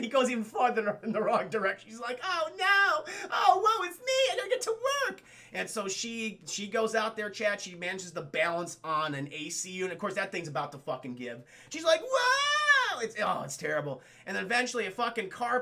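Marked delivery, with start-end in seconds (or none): 2.2-5.2 very high, hammed-up wailing; mock damsel lament. Heroine stuck reaching for the cat, mock wail. Acting heroine's woe on the ledge. 12.0-13.0 long, high, loud howl of alarm; mock falling scream. Woman as the A C unit gives way, mock scream.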